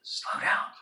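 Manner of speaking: unvoiced hushed "slow down"; buddy whispering the answer across the hallway; whispered answer.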